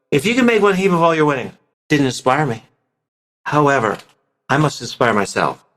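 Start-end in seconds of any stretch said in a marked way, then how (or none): 0.1-1.5 fast, rushed, careless line reading; rushed unpracticed reading. Shown as a demonstration. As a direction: rattle it off without interest. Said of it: "If I was unpracticed, I would go".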